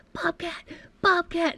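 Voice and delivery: high-pitched mock yell; retold excited yell. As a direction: call out to the staff in a high, imitated yell. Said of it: "I start yelling".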